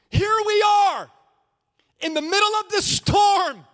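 full-force sermon shout; shouted preaching. Acted. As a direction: hurl the storm at the crowd. Preacher shouting at sleeping Jesus.

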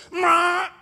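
high, loud mimicked bird cry; piercing fake parrot call; imitated parrot squawk. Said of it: "And she goes".

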